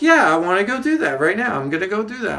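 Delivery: high, loud burst of eagerness; eager comeback. Retold or imitated. Answering scolding companion about helping people, eager. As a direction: jump in loudly, raring to go.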